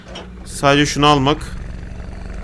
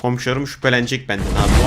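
low, quiet self-check; subdued, worried aside; hushed after shouting; 5 semitones lower, 6 dB quieter, slower than usual. Real, not acted. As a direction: drop to a low murmur after yelling. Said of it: "çok bağırdım".

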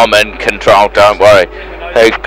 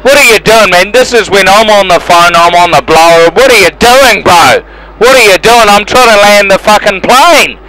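angry rant; 8 semitones higher than usual. Real, not acted. Pilot yelling at another plane blocking his runway, exasperated and angry.

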